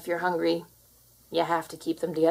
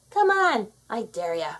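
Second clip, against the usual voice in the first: teasing dare; 4 semitones higher than usual. Acted. Cat daring the human to touch it, teasing taunt. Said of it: "This pose says".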